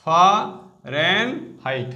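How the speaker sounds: Fahrenheit stretched out slowly, syllable by syllable. Shown as a demonstration. Teacher to students showing the spelling, very slow Fahrenheit. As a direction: drag out Fahrenheit so every part is heard. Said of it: "height spelling কিন্তু".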